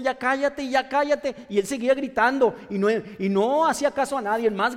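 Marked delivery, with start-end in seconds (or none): 0.0-1.5 crowd telling him off. Retold or imitated. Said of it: "todos le decían".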